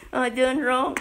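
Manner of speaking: crying out. Acted. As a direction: cry out a confession, lightly acted. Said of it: "I believe he was crying out".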